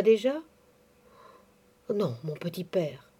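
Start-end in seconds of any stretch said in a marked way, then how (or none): 1.9-3.1 lowered voice. A narrator's reading. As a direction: drop the voice, softer, confiding. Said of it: "abaissant la voix".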